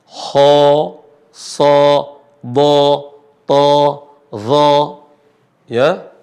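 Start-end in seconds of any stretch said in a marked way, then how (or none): 0.1-4.7 Kha, sa, dha, ta, dha each said thick toward O; heavy Arabic letters. Shown as a demonstration. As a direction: list the heavy letters with rounded, full vowels. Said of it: "huruf yang bunyi mengarah kepada huruf O".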